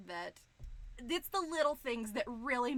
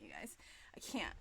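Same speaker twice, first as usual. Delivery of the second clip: breathy little whisper of joy; overwhelmed whisper; 13 semitones lower, 7 dB quieter than usual. Real, not acted. Streamer to chat, overcome, whispering.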